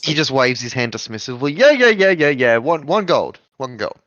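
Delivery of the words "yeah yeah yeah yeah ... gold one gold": dismissive NPC. Acted. NPC waves off contract.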